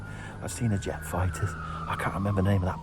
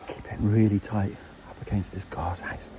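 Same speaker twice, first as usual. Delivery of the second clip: whispering. Real, not acted. Hiding beside guardhouse.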